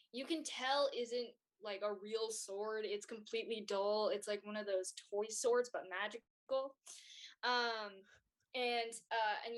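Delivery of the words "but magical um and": ordinary talk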